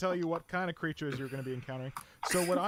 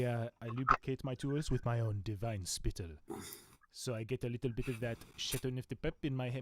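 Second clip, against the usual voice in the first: put-on French accent; 4 dB louder than usual. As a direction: savour every word like a French connoisseur. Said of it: "my weird French accents".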